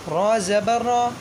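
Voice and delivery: normal talking voice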